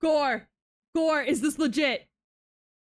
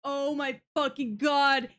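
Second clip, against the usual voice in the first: normal talking voice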